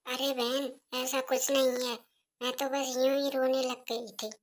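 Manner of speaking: put-on soft, timid young wife offering tea; softly offering tea to her mother-in-law; meek daughter-in-law. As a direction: hand over the tea quietly and politely.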